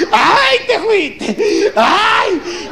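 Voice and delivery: high, slow, drawn-out hammed-up cry; keen for the dead husband, overdone; theatrical widow's shout; mock wailing. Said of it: "se privaba la señora".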